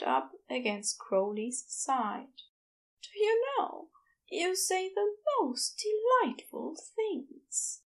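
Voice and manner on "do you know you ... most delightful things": delighted, lilting high voice, lingering on the words; affectionate Aziraphale. Acted. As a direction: voice a sweet, dreamy character up high.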